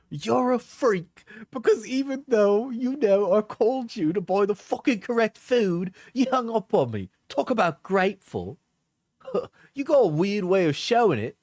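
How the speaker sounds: mocking high girly voice. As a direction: sneer it in a high mimic. Reads her messages in mock voice.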